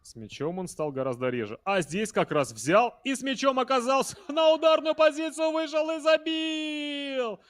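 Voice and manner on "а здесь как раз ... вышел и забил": high, loud goal call; excited goal call. Real, not acted. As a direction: shout the goal with joy. Commentator celebrating a goal. (восторженный крик гола)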